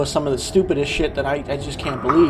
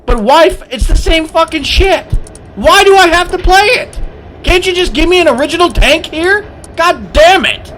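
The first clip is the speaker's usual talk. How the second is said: much higher, near-screaming rage; furious shout; 11 semitones higher than usual. Real, not acted. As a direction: let it explode at the top of your voice. Rant peaks about duplicate tanks.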